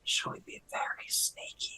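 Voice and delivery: soft, voiceless sneaky whisper. Acted. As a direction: whisper it secretively to the party. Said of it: "turn and whisper and be like".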